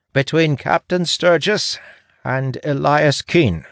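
high, drawn-out announcement; proclaiming scribe. A narrator's reading. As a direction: proclaim it slowly on a high pitch. Scribe proclaims the tied candidates.

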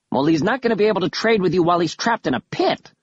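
high, fast protest; yelling. A narrator's reading. Kaz objects to Killiman about the pit, yelling. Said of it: "Kaz yelled".

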